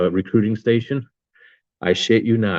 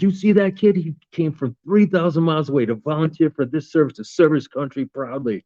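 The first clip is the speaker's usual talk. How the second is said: proud officer; 7 semitones higher than usual. Retold or imitated. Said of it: "I'm like".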